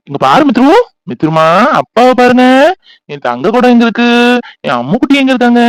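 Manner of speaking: put-on high, sing-out cooing to a baby; baby talk. Father soothing his crying daughter, high baby talk. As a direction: coax the crying child with endearments.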